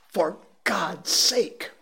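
high, slow desperate cry; pleading in terror; frantic plea. A narrator's reading. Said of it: "in a terror".

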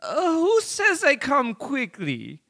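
put-on high, loud German accent. Kim Dot Com answering the hackers in a German accent. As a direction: push a squeaky German accent, slow at the end. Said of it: "a German accent".

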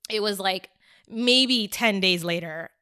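ordinary talk

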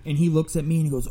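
ordinary talk

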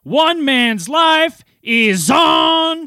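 high, drawn-out championship announcement; slow, hammed-up fight-night hype; ring announcer. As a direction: build to a long shout on the final words.

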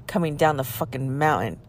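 normal talking voice

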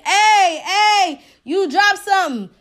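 high, loud imitation of a man hollering; retold street yell; mock screaming. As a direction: call insistently after someone, mock shout. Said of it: "He's still screaming".

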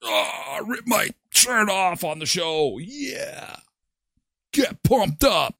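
hyped-up frenzy; getting carried away, pumped; energy drink ad. Real, not acted. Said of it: "Okay, that's enough. Settle".

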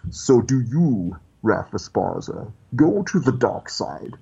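slow, deliberate villain impression; Darth Vader. Villain questions the uncle directly, slow imposing impression. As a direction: draw out each phrase with imperious weight. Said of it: "Vader looked at me, and he was like".

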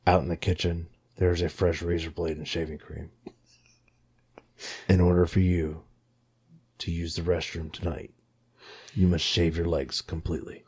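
low, hushed sinister instructions; creepy villain voice. Retold or imitated. The creepy guy setting a midnight task, low and soft. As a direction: lay out the rules like a horror villain.

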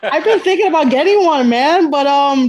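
loud laughing speech. Real, not acted. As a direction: laugh through the words. Laughing after Cameo joke.

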